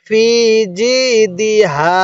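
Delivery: ফি and জি stretched, দি kept unstretched; measured ফি জি, move straight from দি to হা; joined reading. Shown as a demonstration. Teacher joining the words, no stretch on দি. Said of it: "দালের মধ্যে টান দিব না".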